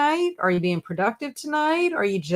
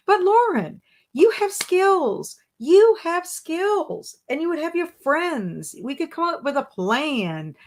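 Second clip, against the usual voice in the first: emphatic reassurance; 8 semitones higher than usual. Real, not acted. Host reassuring Lauren she has skills, high and insistent. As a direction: stretch it out to convince a doubting friend. Cheering on a doubtful viewer.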